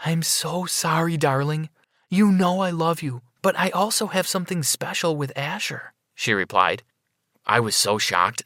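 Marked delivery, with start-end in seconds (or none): 0.0-5.9 high-pitched lover's apology; lightly acted female character voice; apologetic woman. A narrator's reading. Narrator voices nurse apologizing.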